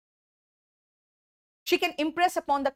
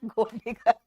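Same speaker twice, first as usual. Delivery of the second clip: laughing. Real, not acted. Laughing at gold digger comment.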